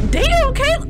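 sudden loud, high exclamation; shocked shout. Real, not acted. Gamer reacting to Caleb in the game, shocked shout. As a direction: yell out in startled disbelief.

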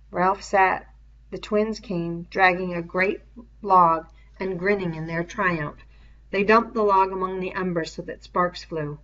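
ordinary delivery, nothing marked

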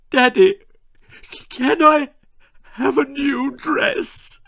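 very high, loud, quavering plea; tearful asking. Shown as a demonstration. Daughter asking her father for a dress, high tearful plea. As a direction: ask through fake tears. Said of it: "No tears equals no dress".

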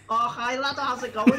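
high, loud Scouse greeting; Scouse accent. Acted. Stomach with teeth greeting the surgeon, comic Scouse accent. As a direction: greet cheerily in hammed-up Scouse. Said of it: "I can do Scouts".

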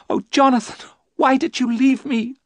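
high, loud, anguished wife; crying out. Acted. Mina crying out to Jonathan, high and anguished. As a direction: wail it at your husband. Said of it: "Mina cried".

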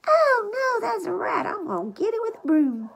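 high, loud mock panic at a rat; pretending to spot a rat on the floor, mock panic; mock alarm. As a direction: shriek it like you spotted a rat. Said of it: "I would say".

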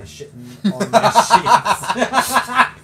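reading through loud laughter; laughing. Real, not acted. Reads comment while laughing.

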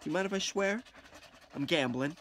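ordinary talk

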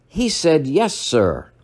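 normal talking voice

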